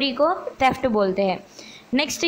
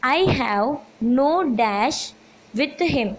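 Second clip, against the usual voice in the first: slow dictation; much slower than usual. Shown as a demonstration. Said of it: "reads exercise with blank".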